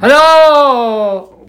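high, loud announcer hello; excited greeting. Acted. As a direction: belt out the opening hello. Podcast intro greeting.

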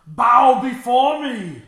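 booming slow villain command; dark lord. Pretend. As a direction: bellow it like a tyrant king. Voicing evil Loki.

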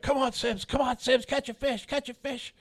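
high mock coaxing chant; imitating Rick's urging. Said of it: "Rick's like".

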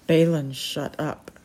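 plain reading voice

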